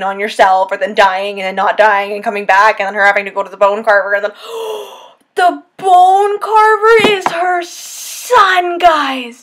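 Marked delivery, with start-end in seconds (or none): 4.4-4.9 quick, breathy intake of shock; sharp gasp. Real, not acted. Reviewer gasping at the twist, sharp and short. 5.4-9.4 awed reveal. Real, not acted. Revealing the plot twist.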